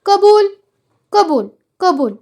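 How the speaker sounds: loud cries of assent, high then dropping; acted shouts of approval; crowd shouting. A narrator's reading. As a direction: chant agreement like an eager crowd. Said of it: "लोक ओरडले".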